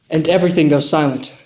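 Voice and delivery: ordinary delivery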